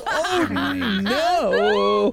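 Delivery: laughing high disbelief; excited. Real, not acted. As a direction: react to a disaster roll. GM reacts to double fail.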